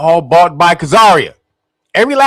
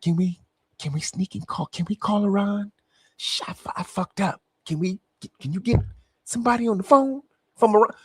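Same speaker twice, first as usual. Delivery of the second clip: much softer, hushed pleading; sneaky hush; 13 dB quieter than usual. Retold or imitated. Trump sneaking a call in a hushed voice.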